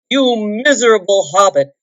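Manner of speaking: loud, sputtering insults; dwarf shaking the hobbit, shouting; angry shout. A narrator's reading. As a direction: shout at a loss for words. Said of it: "he shouted at a loss for words".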